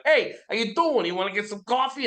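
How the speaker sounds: hammed-up Jersey guy offering coffee; New Jersey accent, fast and cheeky. Shown as a demonstration. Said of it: "I grew up in Jersey".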